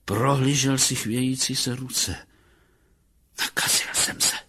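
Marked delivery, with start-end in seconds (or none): 3.4-4.4 unsettled, fully whispered realisation; voiceless, intense whisper; wild whisper. A narrator's reading. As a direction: whisper it wildly to yourself. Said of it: "zašeptal divoce".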